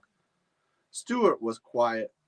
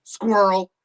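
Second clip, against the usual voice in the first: excited dog alert; 8 semitones higher, 9 dB louder than usual. Acted. Dog spots a squirrel.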